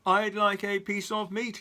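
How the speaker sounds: loud high booming order. Acted. Mister Noisy booms at Mister Bacon, loud. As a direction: boom it out. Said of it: "he boomed".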